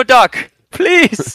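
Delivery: whining very high plea to the Irish doctor; patient begging the doctor in a high whine. Pretend. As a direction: squeak out the please like a desperate patient. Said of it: "I'm begging you".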